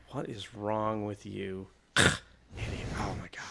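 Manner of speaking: low, slow, very soft contempt; muttered disgust. Retold or imitated. Gruff woman at a clueless man, hushed disgust. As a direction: mutter it under your breath, trailing to a whisper.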